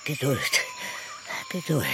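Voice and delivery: low, quiet "Geduld", said twice; a brooding promise to himself; menacing. Acted. Cornelius muttering a threat to himself. (leise drohend, tief)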